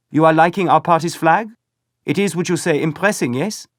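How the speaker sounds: put-on high, loud German-accented youngster; proud boy fishing for praise, German accent. A narrator's reading. Second boy asks Paul about the flag, high German accent.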